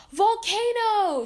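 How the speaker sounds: high, loud, drawn-out alarm yell. A narrator's reading. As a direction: call out the danger at full voice. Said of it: "she yelled to Phineas and Ferb".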